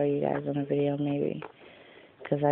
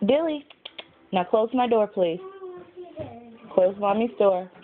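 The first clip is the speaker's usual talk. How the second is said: raised voice to be heard through the house; calling out; 8 semitones higher than usual. Real, not acted. Calling kid across the house.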